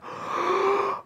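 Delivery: big gasping intake of air before a dive; deep breath. Acted. The diver filling his lungs, a big gasp. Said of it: "he takes a really deep breath".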